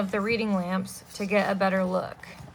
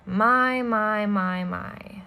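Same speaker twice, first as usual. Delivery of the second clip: sly villain; much slower than usual. Acted. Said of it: "said a voice from behind them".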